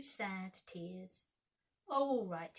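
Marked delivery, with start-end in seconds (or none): none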